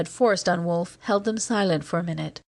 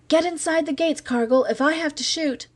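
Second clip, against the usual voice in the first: high, urgent call across the gate; 9 semitones higher than usual. Acted. Pale young guard calling out to Cargill, high urgent call. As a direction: call out to him, edgy and nervous. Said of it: "called out".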